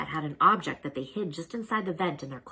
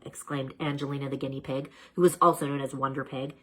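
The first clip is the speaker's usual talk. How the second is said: usual speaking voice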